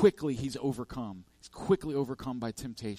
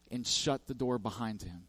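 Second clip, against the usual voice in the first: ordinary talk, nothing marked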